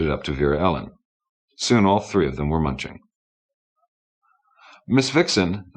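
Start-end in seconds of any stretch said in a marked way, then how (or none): 4.9-5.7 talking between mouthfuls; words round a bite of food; mouth full. A narrator's reading. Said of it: "asked between mouthfuls".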